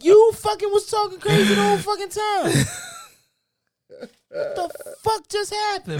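loud, very high-pitched mock outrage; mock outraged outburst. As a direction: yell it high, as if calling someone out. Mock outburst at someone talking crazy.

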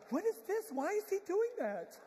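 put-on high-pitched, baffled teenagers' voice; mock bewilderment, pitched right up; puzzled youngsters. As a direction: imitate clueless kids asking in a high voice. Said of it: "Some of y'all going".

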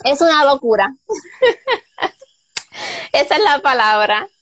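laughing through the words. Real, not acted. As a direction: giggle as you say it. Laughing while answering.

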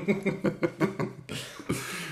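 unvoiced breathy laugh. Real, not acted. Laughs at joke.

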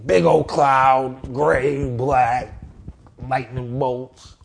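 slow and loud, savouring each image; drawn-out relish. Real, not acted. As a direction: stretch out every word like painting it big. Describing a gloomy drawing.

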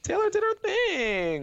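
high, drawn-out praise; hyped. Real, not acted. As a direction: sing her praises, high. Celebrating Taylor.

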